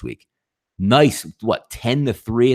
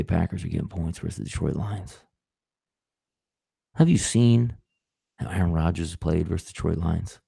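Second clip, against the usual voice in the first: low, hushed radio voice with long pauses; 5 semitones lower, 5 dB quieter than usual. Acted. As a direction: put on a quiet, low radio bettor stunned by a steal. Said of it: "I like to get my radio voice".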